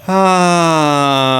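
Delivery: long open ah sighed out; releasing exhale. Shown as a demonstration. Voice coach bringing energy down with a long exhaled ah. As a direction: let the breath drop out on an ah. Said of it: "I bring the energy down".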